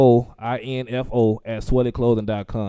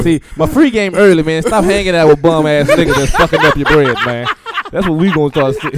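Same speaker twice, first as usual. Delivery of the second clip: loud hype preaching; 4 semitones higher, 6 dB louder than usual. Real, not acted. Loud preachy advice, laughing.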